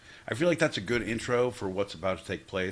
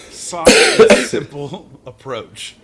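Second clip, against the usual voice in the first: slow and loud, sarcastic; drawn-out mock emphasis; 4 semitones higher, 17 dB louder, much slower than usual. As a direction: stretch it out mockingly. Mocking own gentle approach.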